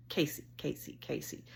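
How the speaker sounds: hushed, voiceless repeated name. Retold or imitated. Woman nudging motionless dog, hushed repeated calling. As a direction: say the name under your breath, prodding.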